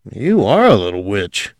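plain reading voice

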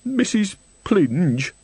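high, very slow tearful answer; sniffing. A narrator's reading. Crying old woman gives Nanny her name, sniffing. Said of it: "sniffed Missus Plinge".

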